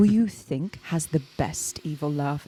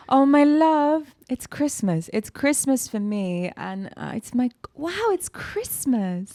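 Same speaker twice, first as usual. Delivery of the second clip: squealing with Christmas delight; excited; 8 semitones higher, slower than usual. Real, not acted. Excited over Christmas treat.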